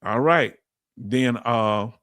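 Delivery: ordinary delivery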